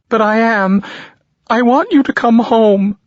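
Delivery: a mother begging through tears; mother demanding her son come home, high and tearful; crying mother. A narrator's reading. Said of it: "Mom, don't cry".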